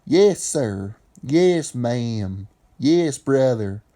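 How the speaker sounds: drawn-out Southern drawl; antebellum Southern speech. Shown as a demonstration. Explainer demonstrating antebellum South talk in a Southern drawl. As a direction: stretch it out like an old-time Southerner. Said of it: "that language was from the antebellum South".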